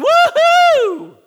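a big high shout of joy; joyful whoop. Acted. Preacher whooping for joy at God's love, loud and high. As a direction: cheer it out at the top of your voice. How we should celebrate.

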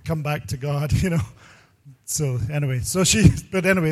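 ordinary talk

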